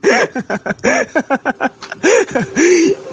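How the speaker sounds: high rising giggle; mocking laugh. Real, not acted. Debater laughing at Pak Lamsen's insults, high mocking laugh. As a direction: laugh him off, high and amused.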